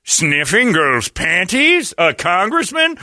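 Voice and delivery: slow, lightly voiced anchor; milk the scandal slowly; mock newsman tease. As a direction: parody of a lurid news promo. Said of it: "He said".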